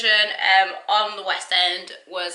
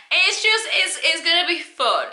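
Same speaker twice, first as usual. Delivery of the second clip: bright and high, louder, bubbling over; excited; 9 semitones higher, 6 dB louder than usual. Real, not acted. Host hyping viewers up for the listen-along, excited. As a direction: sell how fun this will be.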